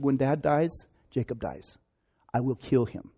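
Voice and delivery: understated murderous resolve; grim vow. Acted. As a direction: swear quiet revenge, lightly acted. Esau vows to kill his brother.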